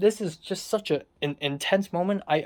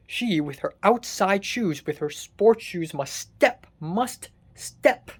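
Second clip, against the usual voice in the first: slow, word-by-word indignation; exasperated emphasis; slower than usual. Real, not acted. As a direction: spell out the offence, drawn out with pauses. Indignant complaint about roommate's shoes.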